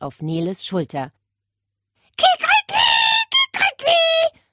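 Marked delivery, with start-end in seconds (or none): 2.2-4.4 high, loud screeching rooster call; screeching crow. Acted. Parrot screeching over Nele, loud shrill crow. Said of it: "kreischte er".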